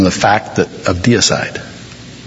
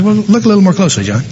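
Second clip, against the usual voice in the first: higher and fast, pressing the point; impatient God repeating himself; quicker repeat; 6 semitones higher, much faster than usual. Acted. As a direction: rush the repeat, more insistent. God repeats himself, more insistent.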